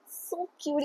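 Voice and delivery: breathy little whisper of delight; whispered gush. Real, not acted. Gushing to viewers about a sweet book moment, whispered. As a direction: whisper it like it melts you.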